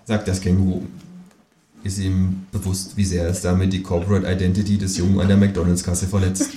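ordinary delivery

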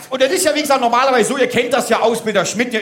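usual speaking voice

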